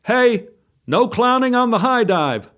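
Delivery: high-pitched lifeguard shout; stern shout, kept short of full volume. Acted. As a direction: call it out as a lifeguard's warning. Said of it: "blew his whistle and shouted".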